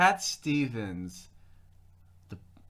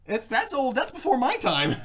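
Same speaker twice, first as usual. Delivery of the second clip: protest; 10 semitones higher, 5 dB louder than usual. Real, not acted. Protesting remark about her age.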